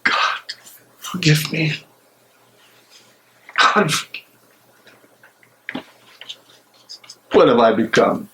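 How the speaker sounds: tormented man arguing with himself alone; a conscience breaking under doubt; anguished soliloquy. Acted. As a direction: pace and wrestle with your own guilt aloud. Bonhoeffer's anguished prison monologue.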